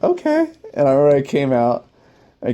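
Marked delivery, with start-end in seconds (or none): 0.0-0.5 high, small nervous okay; mock meek okay. Told the gunny wants him, he answers small and high. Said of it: "I was like".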